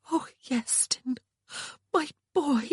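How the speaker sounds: slow whisper breaking into a cry; horrified sister at his wounds; tearful whisper. A narrator's reading. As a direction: whisper it, then sob. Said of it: "Sian whispered".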